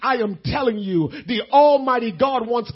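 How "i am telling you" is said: ordinary talk, nothing marked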